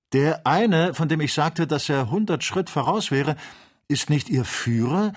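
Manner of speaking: hohe, laute, drängende Stimme; enthülle erregt die Entdeckung; aufgeregte Enthüllung. A narrator's reading. Urgent discovery of the fugitive.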